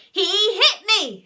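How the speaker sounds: very loud, high accusation; piercing child's tell-tale yell; screech. A narrator's reading. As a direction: screech it in outrage. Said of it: "screeched Henry and Peter".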